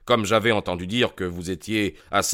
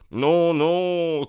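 crying out; 8 semitones higher than usual. Acted. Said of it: "cria Holmes".

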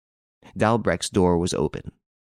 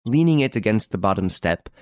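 usual speaking voice

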